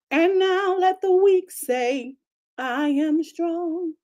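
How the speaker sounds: high, very slow preaching of the verse; slow scripture quote. Acted. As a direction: stretch each word out like proclaiming scripture. Quoting scripture slowly.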